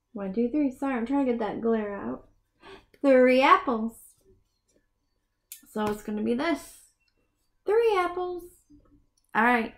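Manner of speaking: usual speaking voice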